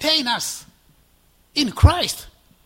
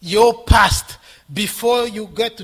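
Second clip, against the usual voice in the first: ordinary delivery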